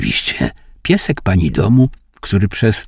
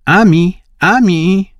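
high, louder call to a little dog; 10 semitones higher, 4 dB louder, slower than usual. A narrator's reading. Lady of the house calls her dog, high and loud. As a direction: sing out the pet's name, higher and louder. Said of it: "zawołała pani".

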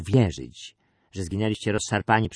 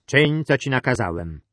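louder, higher, sharp order; stern raised-voice command; 4 semitones higher, 6 dB louder than usual. A narrator's reading. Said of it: "zawołał ostro".

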